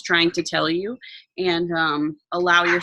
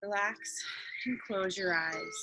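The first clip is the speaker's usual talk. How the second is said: guided meditation; 4 dB quieter than usual. Acted.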